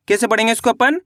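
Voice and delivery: ordinary talk, nothing marked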